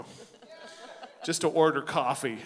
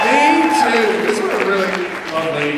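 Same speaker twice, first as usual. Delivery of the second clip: much higher, louder announcer call; emcee hype; 11 semitones higher, 11 dB louder than usual. Acted. Host hyping the crowd for the final performer, emcee call. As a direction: pump the room up for the final act.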